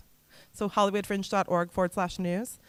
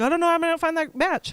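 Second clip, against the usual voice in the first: high-pitched mock worrier; mock fretting; 8 semitones higher than usual. Coach imitating applicants hung up on matching funds, mock fretting. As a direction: fret in a much higher, put-on voice.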